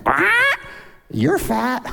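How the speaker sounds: high squawk, then the words in parrot voice. Acted. Parrot insults a man passing the pet store, squawking. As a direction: squawk it like a mocking bird. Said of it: "the parrot went".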